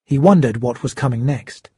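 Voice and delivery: usual speaking voice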